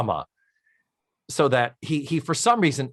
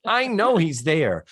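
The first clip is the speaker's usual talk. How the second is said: ordinary talk, nothing marked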